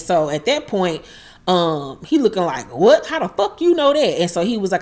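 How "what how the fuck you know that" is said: high-pitched, incredulous retold outburst; mock disbelief. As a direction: imitate someone stunned, voice jumping up. Retold shock at secret revealed.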